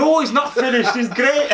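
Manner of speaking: Scottish caller insisting, high laughing fake accent; dodgy Scottish accent. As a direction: squeal it back in a bad Scottish voice. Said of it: "Some dodgy Scottish accent there".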